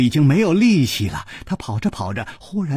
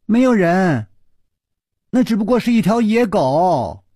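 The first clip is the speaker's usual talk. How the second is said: higher, louder flustered cover-up; nervous; 8 semitones higher, 4 dB louder than usual. Acted. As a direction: blurt a nervous lie. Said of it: "石秀云紧张地说".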